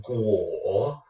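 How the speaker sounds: "guo" drops, then comes back up; third tone. Shown as a demonstration. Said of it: "drops and then comes back up".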